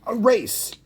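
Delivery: high, excited hare; eager high-pitched exclamation; blurting. A narrator's reading. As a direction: burst in with a sudden idea. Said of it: "blurted the hare".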